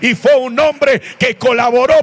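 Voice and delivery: high-pitched, shouted sermon climax; fervent preaching. Real, not acted. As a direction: proclaim it to the whole congregation at full force. Preaching climax at full force.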